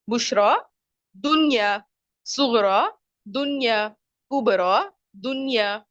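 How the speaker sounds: बुशरा, दुनिया, सुगरा, कुबरा one by one, final aa held; measured, separated बुशरा, दुनिया, सुगरा, कुबरा, each carefully enunciated; pronunciation demo. Shown as a demonstration. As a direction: alternate दुनिया with बुशरा, सुगरा, कुबरा to show the same long ending. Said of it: "इसका तलफ़ुज़ जो है वो".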